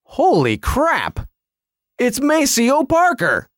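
slow, high, awestruck exclamation; acting out a stunned fan's delight; retold amazement. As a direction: stretch it out as the thrill sinks in. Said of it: "then my brain goes".

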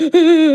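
loud, high exaggerated wail; hammed-up sobbing outburst; mock weeping. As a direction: wail loudly as if bawling over a book. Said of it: "I'm weeping".

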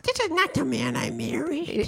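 high, thin, slow mouse-like squeak. Retold or imitated. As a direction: imitate a frail mother in a tiny mouse voice. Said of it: "why do you sound like a mouse?".

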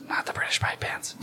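sneaky stage whisper; whispered aside. Acted. Aside within the proclamation.

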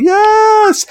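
high, drawn-out cry of delight; excited yes. Real, not acted. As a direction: let out a thrilled, high yes. Delighted at remembering the story.

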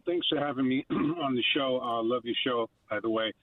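usual speaking voice

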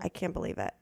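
low, quiet imitation of a mortified technician; hushed disbelief. Technician finding the spray gun empty, imitated in hushed disbelief. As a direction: drop to a soft, low voice for the quote. Retelling the embarrassed technician's reaction.

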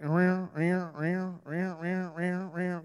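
soft repeated whirring noise; rolling run of mechanical whirs; imitated ride rumble. Showing how the mine train goes along, imitated whirring. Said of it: "And you go like".

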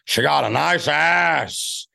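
screaming movie-star impression; Al Pacino. Comedian showing his best line to host, screaming Pacino. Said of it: "I do the screaming Pacino".

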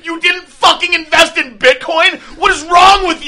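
high, loud, drawn-out disbelief; mock berating shout. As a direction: scream in exaggerated scorn. Rich prankster berates the girls.

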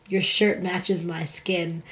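slow, hushed, deadpan line; mock solemn. Telling a stranger in line, quiet and deadpan. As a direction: drop it low and slow like a confession. Retelling what she said.

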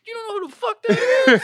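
very high, loud laughing scold; hammed-up angry owner. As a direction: yell at the host in disbelief.

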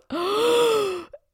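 an imitated sharp gasp; retold gasp. Mom realizing she forgot the presents, a mock gasp. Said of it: "And my mom goes".

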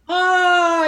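loud, high dismayed cry of Ah; loud high yell. A narrator's reading. Vanessa yelling out as Ron admits defeat, loud and high. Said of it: "yelled Vanessa".

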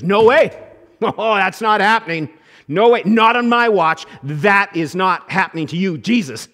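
loud, insistent no way, laughing in disbelief; emphatic refusal. Retold or imitated. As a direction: flatly refuse to let it happen. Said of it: "pretty emphatic on this".

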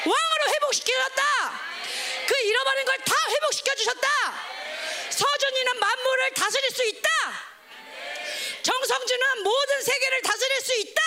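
high-pitched rallying proclamations; impassioned, insistent pulpit cry; full-force preaching. Real, not acted. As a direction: shout each declaration to rouse the congregation.

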